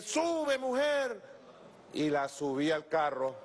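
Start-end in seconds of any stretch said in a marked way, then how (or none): 0.0-1.2 high, urging call; high-pitched mock shout; retold calling out. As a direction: re-enact calling someone aboard. Retold calling woman up to car.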